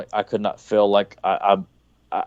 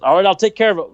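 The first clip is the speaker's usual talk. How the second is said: higher, louder, eager-to-please reply; obliging staffer; 8 semitones higher, 4 dB louder, faster than usual. Retold or imitated. Staffer promising Arthur to fix his coffee, eager. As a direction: chirp it like a helpful assistant.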